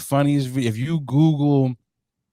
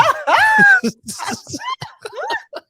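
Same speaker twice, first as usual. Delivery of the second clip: loud high cackle; 7 dB louder than usual. Real, not acted. Cracks up at own punchline.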